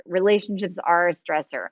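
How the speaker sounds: normal talking voice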